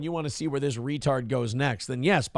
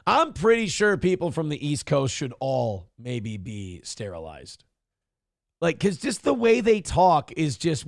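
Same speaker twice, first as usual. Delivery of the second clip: ordinary talk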